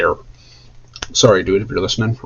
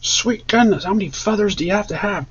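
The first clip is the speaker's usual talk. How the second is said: higher, exclaimed disbelief fading off; astonished exclaim; 10 semitones higher, 4 dB quieter than usual. Real, not acted. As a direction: fan marveling at a feathered costume, higher. Exclaiming at a costume.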